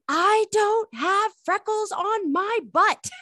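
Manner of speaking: put-on high, slow, huffy little-kid protest; indignant toddler. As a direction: play your toddler self, offended and mad. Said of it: "very, very mad".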